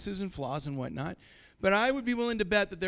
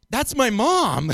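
deadpan retort; 4 semitones higher, 10 dB louder, slower than usual. Retold or imitated. Retold reply to a friend.

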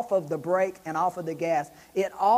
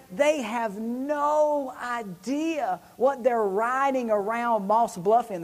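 THEY HAVE NO IDEA slow and raised in pitch; emphatic disbelief; 3 semitones higher, slower than usual. Real, not acted. Preacher marvels at owners using it for errands, slow emphatic disbelief. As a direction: stretch NO and IDEA in amazement. Repeats pronouncement to the congregation.

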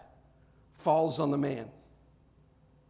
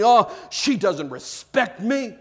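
aggrieved husband grumbling to himself, louder and higher; mock indignant complaint; 5 semitones higher, 6 dB louder than usual. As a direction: act out a mildly overblown grievance. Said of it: "be lying to yourself thinking".

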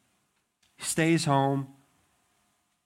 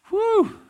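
high relieved whew; 9 dB louder than usual. Retold or imitated. David thinks he's escaped.

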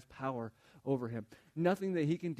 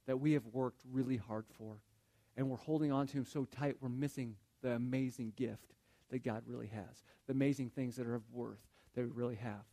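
ordinary delivery

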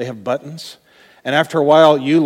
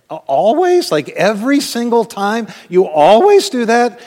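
much higher and louder, mocking disbelief; incredulous retort; 8 semitones higher, 6 dB louder than usual. Answering a spouse's accusation in mock outrage. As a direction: throw the word always back in disbelief.

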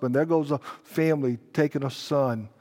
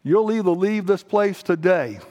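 normal talking voice

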